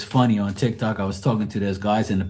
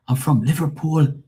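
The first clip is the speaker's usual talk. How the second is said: imitated Scouse, pitched up; Liverpool accent; 6 semitones higher than usual. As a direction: declare it in a put-on Liverpool accent. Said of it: "he was from Liverpool in England".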